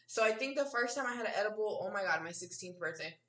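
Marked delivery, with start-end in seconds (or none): none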